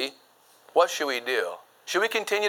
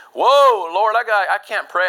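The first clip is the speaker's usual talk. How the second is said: startled outcry; 5 semitones higher, 6 dB louder than usual. Acted. Acting startled believer in prayer.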